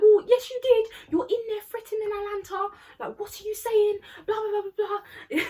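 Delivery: high, loud mock telling-off; accusing. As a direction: imitate a friend arguing back at full pitch. Acted quarrel between classmates.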